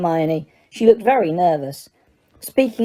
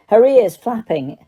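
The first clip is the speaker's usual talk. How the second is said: plain reading voice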